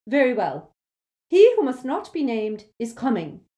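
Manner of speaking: rally the teachers gravely; grave command. Acted. McGonagall telling the teachers, loud and grave.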